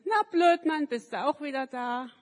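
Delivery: high, loud schoolyard taunt; sing-song teasing call; mocking kids. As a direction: jeer it like cruel children. Children taunting Manni.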